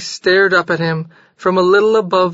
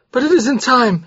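wild; 6 semitones higher than usual. A narrator's reading. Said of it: "he said wildly".

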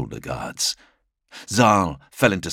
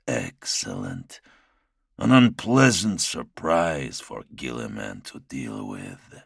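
low sinister lord; 6 semitones lower than usual. Acted. Primarch replies, pleased and dark.

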